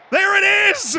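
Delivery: high, loud goal scream; goal yell. Real, not acted. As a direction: shout the goal out in joy. Goal scored, yelling celebration.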